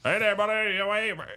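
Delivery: high, loud mock Southern twang. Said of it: "they got, like, a twang to it".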